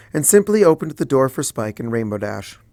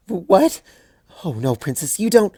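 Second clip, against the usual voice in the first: flustered; 8 semitones higher than usual. Acted. Twilight flustered by apology.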